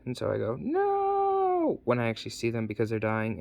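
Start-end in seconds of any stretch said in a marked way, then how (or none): 0.6-1.8 very high, drawn-out "no" wail; mock wail. Finding his parents dying, a high mock wail. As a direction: wail it out in hammed-up horror.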